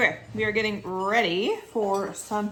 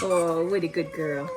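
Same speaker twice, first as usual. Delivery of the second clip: pet talk. Real, not acted. Praising the dog.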